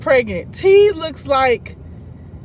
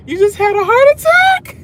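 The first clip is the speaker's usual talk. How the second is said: mock alarm; 10 semitones higher, 7 dB louder than usual. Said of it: "She says".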